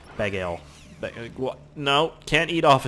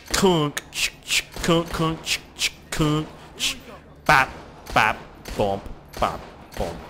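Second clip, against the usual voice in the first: mock hit noises; 4 semitones higher, slower than usual. Imitating hit sounds.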